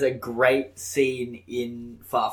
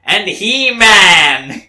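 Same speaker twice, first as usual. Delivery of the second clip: Kraven impression; 5 semitones higher, 9 dB louder than usual. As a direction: announce the hunt with mock menace. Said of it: "how do you think he sounds?".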